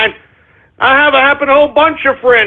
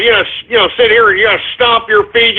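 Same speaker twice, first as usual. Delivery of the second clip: ordinary talk, nothing marked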